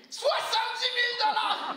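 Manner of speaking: very high, slow, loud host yell; over-the-top game show host shouting the winnings. Acted. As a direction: scream the sum like a TV host.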